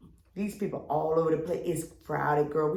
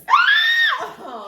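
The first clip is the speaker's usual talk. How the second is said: loud, high burst of laughter; cackling at the request for a new example; high shrieking laugh; 10 dB louder than usual. Real, not acted. Reacts to co-host's interruption.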